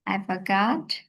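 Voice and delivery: ordinary delivery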